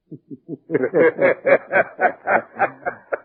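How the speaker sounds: a rueful, disbelieving chuckle that swells; big surprised laugh; hearty laugh. Acted. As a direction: laugh it off, caught out by a partner. [name] laughs at being outfoxed.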